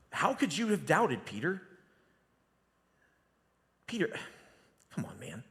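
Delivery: exasperated rebuke of a friend; Jesus scolding a doubting Peter, annoyed; annoyed, let-down tone. Shown as a demonstration. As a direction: sigh it out, fed up with him. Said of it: "an annoyed tone".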